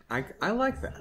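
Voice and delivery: ordinary talk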